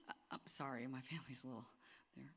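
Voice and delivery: half-whispered apology; whispered aside. Real, not acted. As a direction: drop to a hushed aside.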